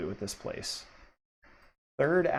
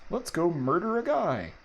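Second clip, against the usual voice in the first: cultist voice; 7 semitones higher, 4 dB louder than usual. Acted. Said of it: "says the cultist".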